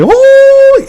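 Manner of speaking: high-pitched, drawn-out imitated scream; a loud mock howl, far above normal pitch. Retelling how the man shot screams, high mock scream. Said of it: "he, like, screams".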